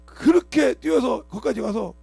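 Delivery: 천천히 목청을 높이며; 절정에서 크게 외치며 끌어; slow loud climax. Acted. Preacher building to climax. (느리고 크게 외치는 설교)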